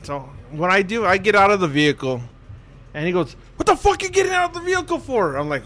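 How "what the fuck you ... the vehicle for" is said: high-pitched, fast mock chewing-out; retold angry challenge. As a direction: imitate an officer demanding answers. Sergeant major chewing out the driver.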